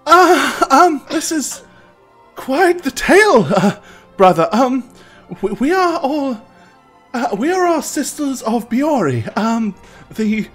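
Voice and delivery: put-on a nervous young temple sister; young priestess voice.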